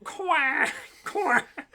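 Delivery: high quacking duck noise; duck quacks. Retold or imitated. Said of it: "This is my impression of a duck".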